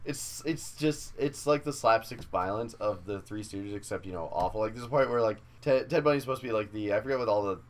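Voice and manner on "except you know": ordinary talk, nothing marked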